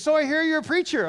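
mock a keen, chatty traveller; friendly stranger. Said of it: "this guy looks at me and says".

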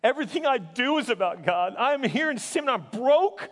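loud, high, anguished protest; anguished yelling. Retold or imitated. Seminary student yelling to best friend about God, angry and anguished. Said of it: "going between anger and yelling and crying".

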